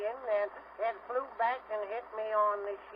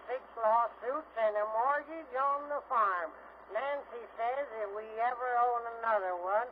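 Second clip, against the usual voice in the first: ordinary talk, nothing marked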